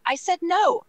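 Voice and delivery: firm no, pitch climbing at the end; angry. A narrator's reading. Millie refuses to be renamed Molly, mildly angry. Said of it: "crosses her arms and look angry".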